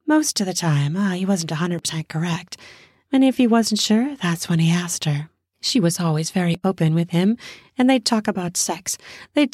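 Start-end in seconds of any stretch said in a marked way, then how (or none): none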